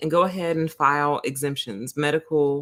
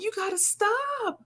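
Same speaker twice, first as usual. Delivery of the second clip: very high, slow, softer plea; high mock pleading; 5 dB quieter than usual. Begging the laughing nurse to stop, high slow plea. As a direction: drag it out high like begging her to quit. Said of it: "This is me talking to the nurse practitioner".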